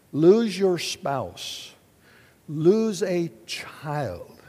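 very slow, weighty words; slow and grave. Real, not acted. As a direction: let each loss sink in slowly. Naming deep losses.

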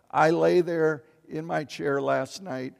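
ordinary delivery

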